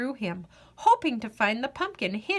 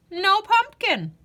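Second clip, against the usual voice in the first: plain reading voice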